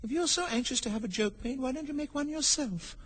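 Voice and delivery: put-on high, soft girl's voice; high little girl's retort; Alice chiding. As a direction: chide softly in a high voice. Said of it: "said Alice".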